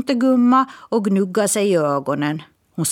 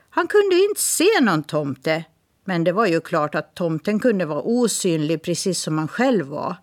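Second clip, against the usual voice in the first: usual speaking voice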